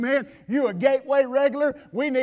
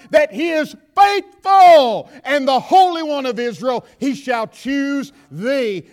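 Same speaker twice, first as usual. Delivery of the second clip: rising, louder preaching of scripture; preaching at full force; 2 semitones higher, 5 dB louder than usual. Acted. Preacher calling scripture out to the congregation, building to a shout.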